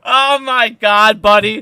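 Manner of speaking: bursting into a loud laugh; real laughter. Real, not acted. Laughing at his buddy Trey's perfect timing. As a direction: crack up for real.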